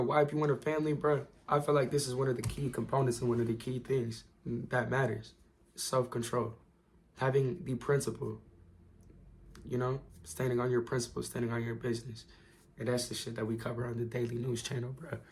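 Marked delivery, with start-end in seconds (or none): 4.4-15.2 losing voice. Real, not acted. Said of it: "Dang, I'm losing my voice".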